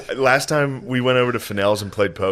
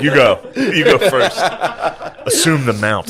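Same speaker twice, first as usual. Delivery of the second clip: mock wrestler; 3 semitones higher than usual.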